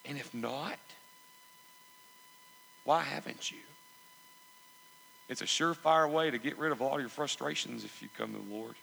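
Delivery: ordinary talk, nothing marked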